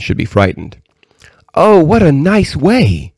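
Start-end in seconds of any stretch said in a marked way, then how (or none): 1.5-3.1 high, drawn-out delight; delighted cry. A narrator's reading. Princess admiring the pigeonholes, a high delighted cry. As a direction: exclaim it slowly, pitched way up. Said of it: "cried the princess".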